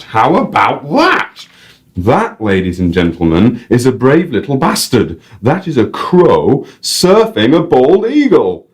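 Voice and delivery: high, lively Northern English guide; Northern English accent. Acted. Guide to the audience, high Northern English accent. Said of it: "random North English accent".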